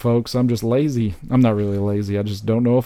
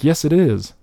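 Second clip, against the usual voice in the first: insistent, higher and louder retort; defiant comeback; 7 semitones higher, 5 dB louder than usual. Acted. Arguing back at people who call the topic unimpressive, defiant. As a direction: push back hard in a mock argument.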